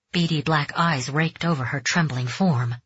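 ordinary delivery, nothing marked

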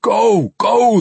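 high, loud, urgent shouts; two hard, urgent bursts; yelling. Acted. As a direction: yell to send her racing off. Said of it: "the dwarf yelled at her".

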